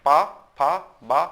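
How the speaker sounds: पा, फा, बा made with both lips; lip consonant drill. Shown as a demonstration. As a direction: demonstrate पा, फा, बा as labial sounds. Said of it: "ओंठों का प्रयोग हो रहा है".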